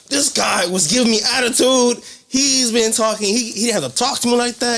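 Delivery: high-pitched, aggrieved imitation; indignant complaint. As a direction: complain like an offended customer. Acts out customer complaining to coworker.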